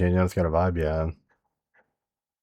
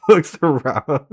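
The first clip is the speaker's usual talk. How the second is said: laughing; 7 semitones higher, 4 dB louder, much slower than usual. Real, not acted. Still laughing.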